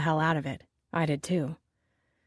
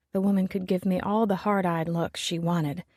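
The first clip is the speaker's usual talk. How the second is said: ordinary delivery, nothing marked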